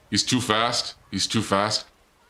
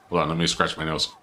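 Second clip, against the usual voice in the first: ordinary talk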